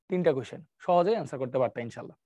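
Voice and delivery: ordinary talk, nothing marked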